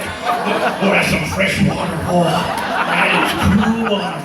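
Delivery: laughing loudly through a fake happy remark; mock delight, laughing. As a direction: ham up loving the outhouse water. Mock joy at outhouse water.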